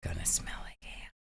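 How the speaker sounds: whispering under breath; hushed whispered aside. Real, not acted. Quiet joke aside.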